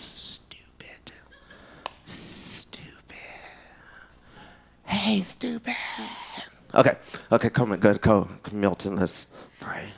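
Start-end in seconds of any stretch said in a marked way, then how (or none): 0.0-5.8 whisper. Pretend. Teasing God voice, whispering.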